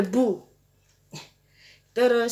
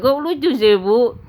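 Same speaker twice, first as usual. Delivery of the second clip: amused teasing; 8 semitones higher, 5 dB louder than usual. Real, not acted. Teasing mum's curly hair photo.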